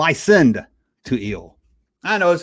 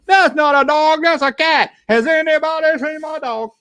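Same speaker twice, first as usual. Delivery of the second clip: Muppet voice; 12 semitones higher than usual. Retold or imitated. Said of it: "He would go up and say".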